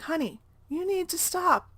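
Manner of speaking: soothing. Retold or imitated. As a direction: imitate a stranger gently calming a child. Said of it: "really soothingly talked to my son".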